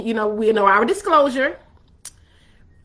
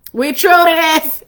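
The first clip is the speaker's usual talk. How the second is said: nineties slang; 4 semitones higher, 6 dB louder, slower than usual. Pretend. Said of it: "that saying? That's from the nineties".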